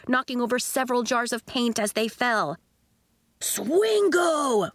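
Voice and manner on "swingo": higher thrilled cry; boy cheering at the mess; excited boy. A narrator's reading. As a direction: whoop at the chaos. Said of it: "cried another boy".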